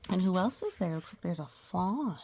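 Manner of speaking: ordinary delivery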